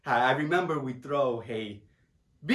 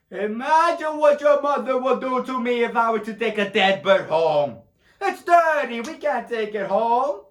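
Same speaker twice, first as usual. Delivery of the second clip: loud, high fatherly protest; worked-up, raised-voice objection; exasperated scolding; 6 semitones higher, 6 dB louder than usual. Acted. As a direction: scold your son at full voice. Father scolds son over bird.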